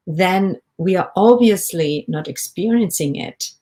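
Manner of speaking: usual speaking voice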